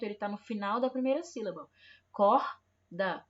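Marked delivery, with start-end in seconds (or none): 2.1-3.2 Corda with the R as a throaty h; strong R. Shown as a demonstration. Teacher stressing the strong R in corda. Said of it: "o R tá tendo o som forte".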